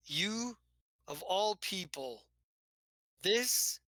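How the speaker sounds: a hint of wounded disbelief; indignant rebuke. Telvono rebukes PV.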